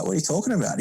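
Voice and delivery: light imitation of being baffled; mock confusion.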